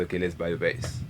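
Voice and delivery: ordinary talk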